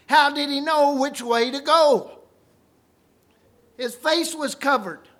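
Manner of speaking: loud, high, slowed preaching build; full-force preaching. Acted. Preacher telling the congregation of Lazarus, full-force preaching. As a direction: preach it with rising fervour.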